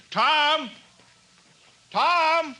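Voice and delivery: high, loud shout across the village; calling out. Acted. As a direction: call his name far and wide. Mayor calling hidden Tom.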